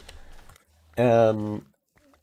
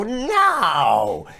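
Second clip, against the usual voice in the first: a higher, flat-out mock no; curt mock refusal; 10 semitones higher than usual. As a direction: refuse like a smug clerk. Staff refusing sandwich.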